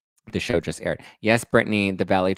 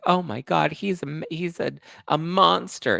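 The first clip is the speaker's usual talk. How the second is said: mock outraged fans; 7 semitones higher than usual. Host mocks fans calling Trevor a monster, higher. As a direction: overact the outrage. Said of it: "Everyone's like".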